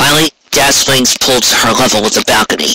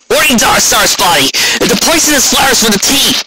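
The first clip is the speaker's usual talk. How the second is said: much higher, sharp snapped retort; snapping back; 12 semitones higher than usual. A narrator's reading. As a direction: snap back in self-defense. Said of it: "Rainbow Dash snapped back".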